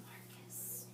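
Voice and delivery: whispered name. Real, not acted. Teacher whispers to student to stay quiet.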